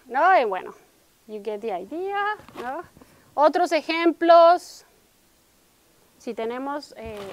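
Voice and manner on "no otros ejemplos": ordinary talk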